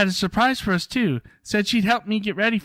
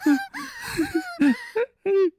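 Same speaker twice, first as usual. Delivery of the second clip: hysterical laugh-cry. Acted. Breaks down in panic.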